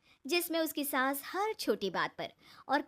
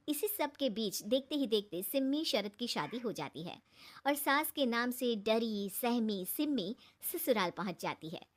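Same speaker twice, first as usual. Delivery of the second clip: usual speaking voice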